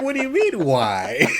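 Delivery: high-pitched laughing disbelief; mock incredulity. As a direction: throw it back, baffled and amused. Acts out own incredulous reply.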